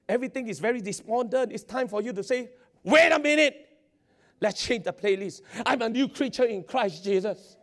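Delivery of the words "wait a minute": high, loud call to halt; firm stop. Retold or imitated. Preacher urging the congregation to interrupt, loud. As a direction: cut the lie off sharply. Believer pushes back.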